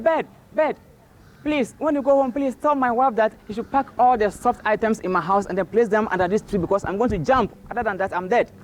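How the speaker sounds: high-pitched, urgent acted plea; pleading tortoise. Stranded Tortoise begs a passing bird for help, high plea. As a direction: call out high, then beg for help.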